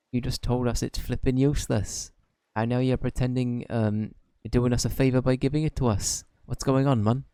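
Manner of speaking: put-on lightly Welsh-accented fairy sidekick, chatty; Welsh Drippy voice. Drippy chats to Oliver in a mild Welsh accent.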